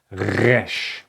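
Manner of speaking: "Resh" with a gargled throat R; throaty resh. Shown as a demonstration. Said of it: "show you how you can pronounce it correctly".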